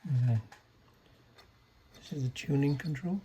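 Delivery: ordinary talk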